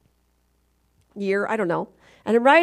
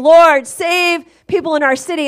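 prayer quote; 5 semitones higher, 7 dB louder than usual. Retold or imitated. Quoting a prayer meeting.

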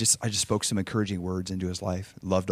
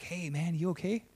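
soft, higher, tentative check-in; gentle concern; 8 semitones higher, 9 dB quieter than usual. Retold or imitated. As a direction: softly check on an upset little kid. Retold gentle check on sad boy.

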